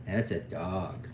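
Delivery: gentle praise. Acted. Praising the toddler.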